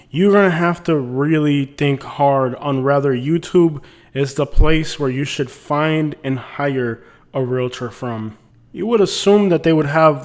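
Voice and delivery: ordinary talk, nothing marked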